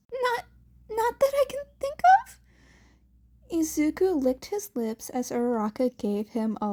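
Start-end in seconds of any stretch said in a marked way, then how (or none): 0.1-2.4 high, fast, loud nervous lie; flustered denial. Acted. Izuku nervously denying to Uraraka. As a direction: deny too quickly.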